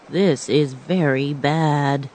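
grave and measured, dreading it; Tin Man's voice. A narrator's reading. Tin Man on the raft, slow and worried. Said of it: "said the Tin Man".

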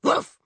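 short loud woof; dog bark. A narrator's reading. Bewitched Kate answering her mother, short bark. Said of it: "she barked".

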